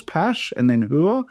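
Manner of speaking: "Pash", then "Hur" as hoo-uh, each on its own; syllables split. Shown as a demonstration. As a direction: slowly separate "Pash" and "Hur". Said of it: "you've got two syllables".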